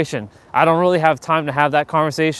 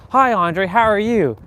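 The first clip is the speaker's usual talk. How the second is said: higher-pitched, mock-polite greeting; superficial small talk; 6 semitones higher than usual. Acting out a superficial greeting to Andre, higher and polite. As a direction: greet with empty, polite pep. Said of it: "they're boring, and they're monotonous".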